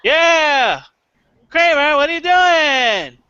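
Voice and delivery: high, loud, slow sitcom whine; Seinfeld inflection. Retold or imitated. Comic imitating Jerry Seinfeld's sitcom delivery. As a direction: do Jerry yelling at his neighbour. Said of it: "you just have to put the inflection".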